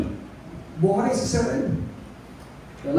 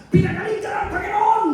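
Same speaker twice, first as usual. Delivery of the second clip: proclaiming shout; 6 semitones higher than usual. Retold or imitated. Pastor proclaiming healing.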